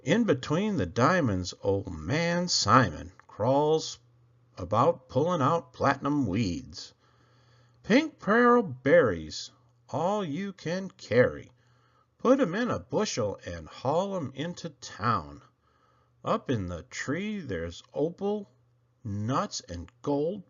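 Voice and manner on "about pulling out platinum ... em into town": usual speaking voice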